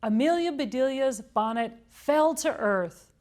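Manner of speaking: ordinary delivery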